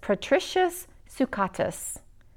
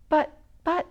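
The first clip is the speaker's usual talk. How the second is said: higher, halting stammer; stuttering; 7 semitones higher than usual. Acted. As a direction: trip over the words in dismay. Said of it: "stuttered Patrick".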